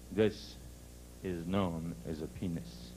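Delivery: low, slow father voice; dad giving his son a solemn lesson; explaining father. Acted. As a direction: explain it gravely, low and measured. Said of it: "His father naturally saw what the kid was staring at".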